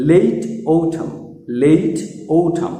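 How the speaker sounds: slow লেট অটাম, gliding ei and aspirated t; careful drill of লেট অটাম as "leyit outham"; practice pronunciation. Shown as a demonstration. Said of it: "এইভাবে practice করো".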